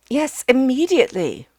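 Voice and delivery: hearty bellowed command; shouting. Acted. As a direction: yell it so she hears. Ukridge shouts to the deaf housekeeper.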